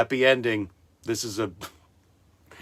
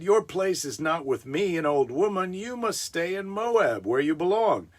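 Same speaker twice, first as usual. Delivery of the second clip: put-on higher, old-woman voice; a hint of old Naomi; 7 semitones higher than usual. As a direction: play the old widow. Naomi speaking in story.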